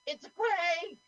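high-pitched panicked cry; freaking out. Acted. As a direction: yell it high, stretching out quake. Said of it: "now she freaks out and goes".

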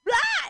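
sudden high shriek of shock; shocked scream. Retold or imitated. Recoiling from the bathroom door with a high shriek. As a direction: shriek at what she just saw.

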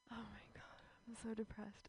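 breathy dramatic whisper. Acted. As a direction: whisper it, mock despair. Mock despair opener.

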